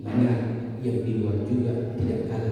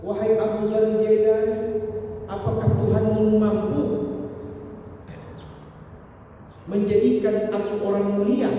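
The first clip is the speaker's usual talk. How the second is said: put-on pleading drunk man; 9 semitones higher than usual. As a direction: cry out the hope of honour. Drunk man's second question.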